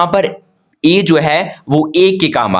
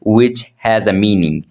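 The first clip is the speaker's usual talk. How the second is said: "which has a meaning" spelled out very slowly; slow dictation; 4 semitones lower, much slower than usual. Shown as a demonstration. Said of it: "a word is a group of letter". ("which has a meaning" बहुत धीरे)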